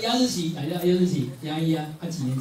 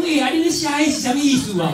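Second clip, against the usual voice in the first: higher, louder curious exclaim with a laugh; surprised question; 8 semitones higher, 6 dB louder than usual. Pretend. A New Year visitor reading the couplet, surprised and loud. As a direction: ask it amazed and amused.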